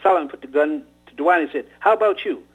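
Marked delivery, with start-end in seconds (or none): 1.8-2.4 sharp demand. Acted. Gunman questioning second boy.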